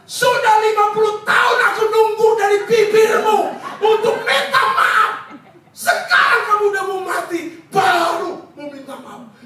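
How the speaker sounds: retold outburst of a bitter husband; angry husband shouting. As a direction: hurl fifty years of resentment at her. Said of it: "Suaminya marah sekali".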